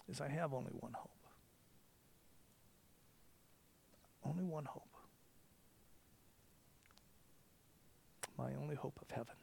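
soft, hushed prayer voice; quiet confession. Real, not acted. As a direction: confess it softly, almost to yourself.

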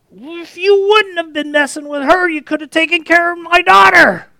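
high, loud accusing shout; angry father. Acted. Grieving father yelling blame at Jesus, angry. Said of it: "Can you imagine the anger?".

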